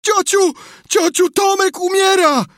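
very high, loud panicked scream; yelling. A narrator's reading. Sid racing downstairs to fetch aunt, screaming. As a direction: yell for help at full pitch. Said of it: "i wrzeszczał".

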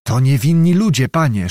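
usual speaking voice

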